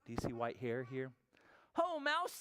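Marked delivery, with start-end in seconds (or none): 1.7-2.3 high, drawn-out hare voice; hare's greeting. Acted. Hare greeting an old friend Mouse, high character voice. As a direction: call out as a delighted storybook hare, pitched high. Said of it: "says Hare".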